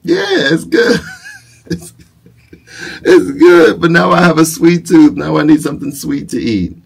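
put-on squeaky delighted voice for the toddler; high silly voice. Dad in pretend tea party.

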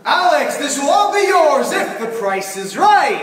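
loud, high, laughing announcer call; big booming prize announcement; hyped game show host. Retold or imitated. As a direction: whip up the crowd like a TV host. Host hypes contestant.

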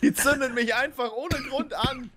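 high, slow whiny complaint. Acted. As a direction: whine it in a high put-on voice. Complaining about Blazes.

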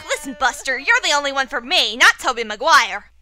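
normal talking voice

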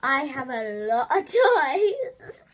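slow, exaggerated vlogger impression; hammed-up YouTuber voice, dragging it out; Nudorella. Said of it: "that's what she sounds like though. She's like".

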